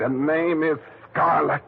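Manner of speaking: indignant correction. Acted. Leo corrects his old name.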